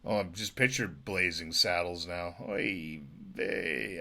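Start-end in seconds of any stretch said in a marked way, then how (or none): none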